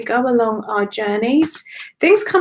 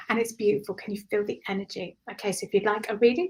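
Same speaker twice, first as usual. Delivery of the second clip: ordinary delivery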